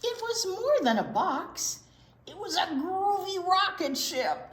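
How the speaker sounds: high, bright announcement of the rocket; excited reveal. A narrator's reading. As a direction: reveal it with a big wow. Big reveal to kids.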